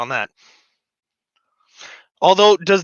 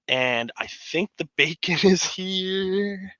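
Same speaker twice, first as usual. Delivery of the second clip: laughing; 5 semitones higher, 4 dB quieter, slower than usual. Real, not acted. Bacon delivery arrives, laughing.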